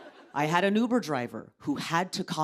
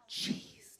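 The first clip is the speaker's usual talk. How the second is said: ordinary talk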